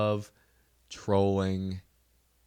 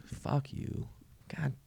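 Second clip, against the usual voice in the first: hushed, frustrated swearing; muttered cursing; 5 semitones higher, 9 dB quieter, slower than usual. Acted. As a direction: curse them under your breath. Cursing tall people under his breath.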